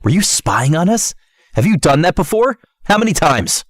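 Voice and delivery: fed-up interrogation; out of patience. Acted. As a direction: demand answers, voice climbing high. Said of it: "clearly at the end of his patience".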